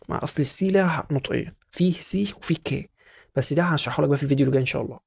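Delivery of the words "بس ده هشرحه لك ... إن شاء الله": ordinary talk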